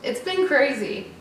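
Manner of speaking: choked up, pushing through the words; getting emotional. Real, not acted. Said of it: "sorry, I'm getting emotional".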